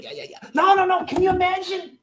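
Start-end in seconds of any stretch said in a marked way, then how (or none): 0.0-0.5 dismissive. Retold or imitated. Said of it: "we think".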